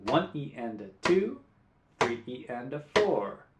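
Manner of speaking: teacher counting sixteenth notes aloud in time; rhythm counting. Shown as a demonstration. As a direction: count the syllables steadily to the guitar. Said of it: "try counting along with me".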